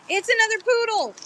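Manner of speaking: high squeal, another leaning out; excited squeal. Real, not acted. Seller spotting yet another poodle, squealing. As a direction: squeal with delight at the find.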